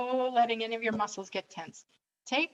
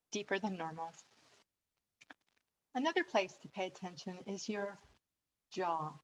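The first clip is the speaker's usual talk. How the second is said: guided meditation; 6 dB quieter than usual. Acted. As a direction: ease them into relaxing, with long pauses. Leads a breathing exercise.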